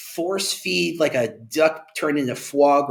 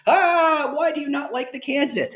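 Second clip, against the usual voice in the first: much higher, hurt candidate voice; baffled candidate; 14 semitones higher than usual. Retold or imitated. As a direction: squeak it like a wounded job seeker. Candidate reacting to cold ad.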